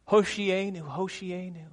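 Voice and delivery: high-pitched crowd acclamation; retold crowd shout. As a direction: call it out high, like a cheering crowd. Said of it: "and they shout".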